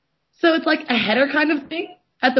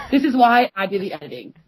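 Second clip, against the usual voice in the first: ordinary talk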